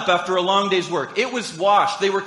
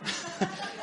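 a quiet unvoiced chuckle at the joke; soft breathy laugh; 11 dB quieter than usual. Real, not acted. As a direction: laugh under your breath. Laughing at his son's joke.

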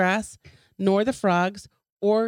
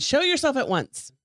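higher, forced brave demand; mock menacing command; 8 semitones higher than usual. A narrator's reading. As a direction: bluff toughness into the dark. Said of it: "trying to sound brave and menacing".

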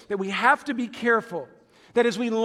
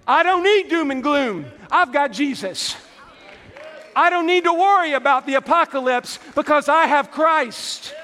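loud, high-pitched preacher's declaration; rousing pulpit proclamation; fired-up preaching; 6 semitones higher, 5 dB louder than usual. Real, not acted. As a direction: preach it out with full-force conviction. Preacher rises to full force.

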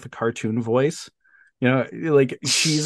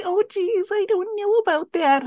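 much higher squeaky cartoon mouse voice. Retelling the heroine's line in a squeaky cartoon mouse voice. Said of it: "a cartoon mouse voice".

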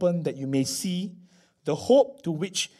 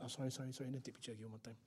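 much softer, lower mock apology; sheepish apology; 6 semitones lower, 23 dB quieter, much faster than usual. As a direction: fake sorry then sneak again.

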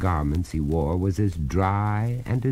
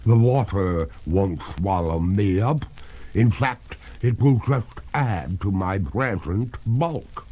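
water ghost, defiant; 3 semitones higher, 3 dB louder than usual. Acted.

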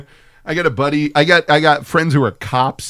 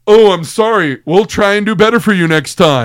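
mock apology; 6 semitones higher, 5 dB louder than usual. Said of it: "And he went".

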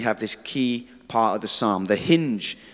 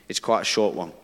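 ordinary talk, nothing marked

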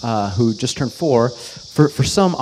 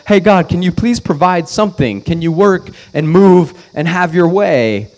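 pleading prayer; 7 semitones higher, 7 dB louder than usual. Retold or imitated. Voicing a plea to God.

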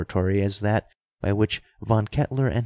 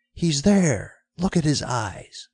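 higher-pitched, contained yell; narrated yell; 6 semitones higher than usual. A narrator's reading. Someone spotting Dick among the attackers, mildly yelled. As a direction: read it as a mild cry of alarm. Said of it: "Someone yelled".

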